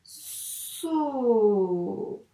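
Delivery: long stretched "So"; syllable drawn out. Shown as a demonstration. Teacher writing the syllable for a pupil, drawn-out "So". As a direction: say "So" slowly, holding the vowel.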